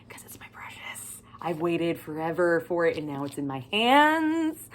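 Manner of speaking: a hint of Smeagol, whispered and slowed; lightly voiced Smeagol guarding the book. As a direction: coo over it like a precious ring. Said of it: "I turned into Smeagol".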